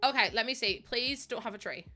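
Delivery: usual speaking voice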